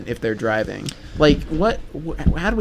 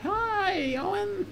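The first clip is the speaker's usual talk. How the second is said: high sweet greeting; 9 dB quieter, much slower than usual. Acted. Greeting on request.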